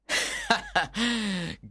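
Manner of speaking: high mocking laugh; teasing laugh. A narrator's reading. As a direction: laugh at the lovesick friend. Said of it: "Mercutio laughed".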